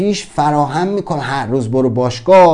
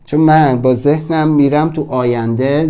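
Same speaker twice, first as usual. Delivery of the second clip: normal talking voice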